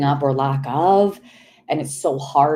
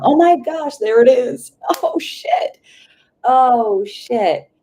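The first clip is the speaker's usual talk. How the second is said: stunned excitement; 8 semitones higher, 6 dB louder, slower than usual. Real, not acted. Medium suddenly struck by her vision.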